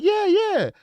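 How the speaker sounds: playing back his own delighted yes; excited recognition. Retelling how he answered the grateful victim, excited. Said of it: "I was like".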